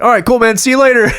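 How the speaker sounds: high, loud pretend send-off; mock cheery goodbye. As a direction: sing out a joke goodbye, high. Joking imagined goodbye in plain accent.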